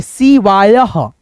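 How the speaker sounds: शिवायः spelled out very slowly syllable by syllable, ending in a breathy "ah"; teacher says शिवायः slowly for students to copy; slow clear demo. Shown as a demonstration. Teacher slowly shows students how शिवायः sounds. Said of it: "अब देखो यहाँ पे क्या है".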